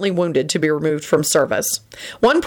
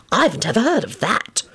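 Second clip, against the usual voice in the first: higher-pitched eager curiosity; enthusiastic; 4 semitones higher than usual. A narrator's reading. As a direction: sound keen to learn about it. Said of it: "Lupin said enthusiastically".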